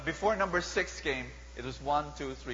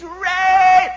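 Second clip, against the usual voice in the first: piercing high scream at full volume; 14 semitones higher, 15 dB louder than usual. Acted. Potiphar's wife screaming to accuse Joseph, full shriek. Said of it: "and screams".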